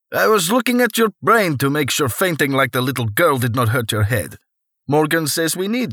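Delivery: a cheerful Russian doctor explaining the brain check; heavy Russian accent. Acted. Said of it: "the bald man with the heavy Russian accent said".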